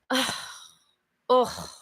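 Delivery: heavy bored sighs, clearly fed up; bored sighing. Shown as a demonstration. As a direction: sigh and drag it out like you're bored. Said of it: "if I'm sitting here like this".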